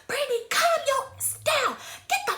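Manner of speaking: high-pitched imitation of an angry mother; mock-mom telling-off; cussing-out mother. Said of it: "she's cussing me out".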